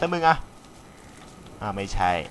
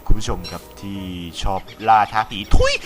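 lower, hollow ghost-hunter voice; spooky put-on voice; 6 semitones lower than usual. Host calling out to ghost-hunting viewers in a lower eerie voice. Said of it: "ทำเสียงแบบนี้ดีกว่าครับ".

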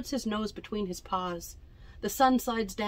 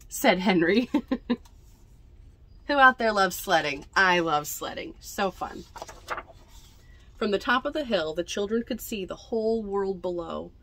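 usual speaking voice

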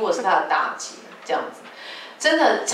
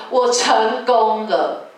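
slow, louder, each syllable given weight; slow confident declaration; 3 semitones higher, 4 dB louder, much slower than usual. Shown as a demonstration. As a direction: declare success to the setback. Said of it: "你要说".

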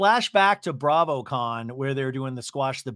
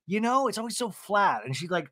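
usual speaking voice

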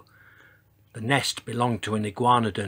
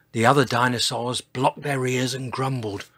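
ordinary delivery, nothing marked

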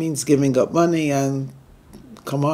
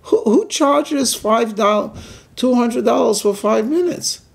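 outraged disbelief; 8 semitones higher than usual. Acted. Customer protests to the dentist about the fee, indignant disbelief. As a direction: demand to know who charges that much.

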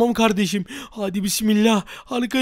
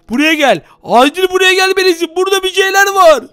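louder, higher urgent calling; urgently calling his friend to come see; 5 semitones higher, 5 dB louder than usual. Real, not acted. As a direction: call your friend over fast, it's urgent. Urgently calls friend over.

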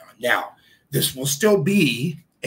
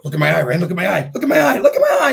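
rising higher and louder, ending in a laugh; playful building tease; 8 semitones higher, 11 dB louder, much faster than usual. Retold or imitated. Dad teasing his little daughter, climbing into a squeaky laugh. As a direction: coax a giggle, getting closer each time.

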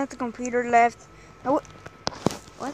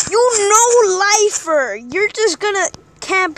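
taunting yell; 7 semitones higher, 10 dB louder, slower than usual. Real, not acted. Taunting camper loudly.

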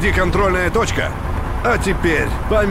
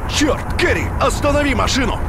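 sudden urgent alarm, pitched up; alarmed shout; 7 semitones higher than usual. Acted. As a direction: yell for the car to stop.

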